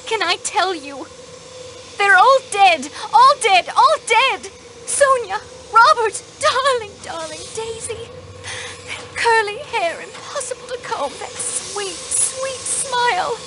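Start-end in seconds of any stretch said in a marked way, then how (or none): 2.0-6.2 anguished cry. Acted. Grief-stricken outburst about dead family. 6.4-13.4 tearful. Acted. Weeping over the dead niece.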